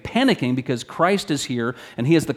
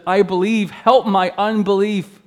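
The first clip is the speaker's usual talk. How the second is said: high, loud, slow desperate cry; cried out; 8 semitones higher, 6 dB louder, slower than usual. Acted. Father crying out to Jesus, loud desperate plea. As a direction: cry out, voice breaking with need. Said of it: "cried out and said".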